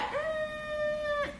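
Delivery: high skeptical hum through closed lips; doubtful hum. Retold or imitated. Vlogger replaying her reaction, skeptical high hum.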